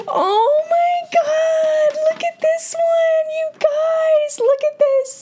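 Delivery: gasp, then extremely high slow squeal; sky-high, stretched-out gushing delight; ecstatic squeal. Real, not acted. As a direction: squeal it out, utterly thrilled. Gasps at next fan art.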